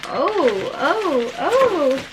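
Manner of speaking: drawn-out oohs swooping up and down; three rising, swooping coos of delight; excited cooing. Real, not acted. Vlogger cooing over new makeup, excited oohs.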